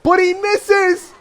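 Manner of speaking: high, loud, gleeful cry; delighted burst of laughter; laughing shout. Real, not acted. Opponent's lightning misses.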